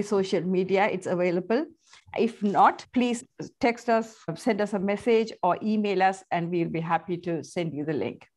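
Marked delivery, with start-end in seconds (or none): none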